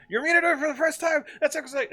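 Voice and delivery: hammed-up excited girlfriend voice, high; excited girl. Excited girl popping up retold.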